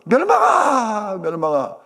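high, loud drawn-out call, trailing down; 이름 부르며 찾기. Acted. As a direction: call a lost child across the village. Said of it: "온 동네를 돌아다니면서". (길게 외쳐 부르는 소리)

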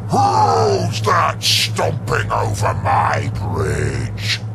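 slow booming troll roar. Acted. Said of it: "he roared".

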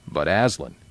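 a touch of humbled unease; shaken voice. A narrator's reading. Proud horse humbled before Aslan, mildly shaken voice. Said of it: "said Bree in a shaken voice".

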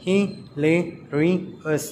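slow "hilarious" in four separate beats; syllable by syllable. Shown as a demonstration. Teacher counting syllables for children, "hilarious" slowly split in four. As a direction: break "hilarious" into hi, la, ri, ous with pauses. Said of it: "four syllables".

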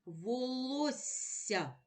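«Волосся» повільно по складах; читає «Волосся» розтягнуто; slow reading. Shown as a demonstration. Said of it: "Прочитай перше слово". (повільне читання по складах)

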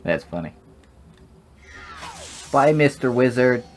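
usual speaking voice

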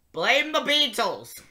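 ordinary delivery

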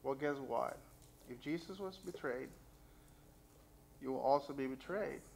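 ordinary talk, nothing marked

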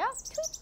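high rising chirpy tweet; bird tweet imitation. Shown as a demonstration. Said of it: "이런 소리를 내기도".

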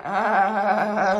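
loud, long mock laugh; joke laugh. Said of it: "that was a joke".

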